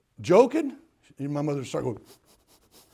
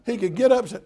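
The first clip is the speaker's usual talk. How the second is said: emphatic preaching; 7 semitones higher, 11 dB louder than usual. Real, not acted. Emphatic about father.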